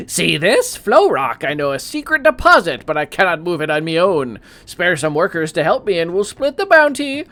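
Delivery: high, loud scheming prospector voice; old prospector. Acted. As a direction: whisper a secret deal like an old miner. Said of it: "An old prospector bird covered in dirt hails you".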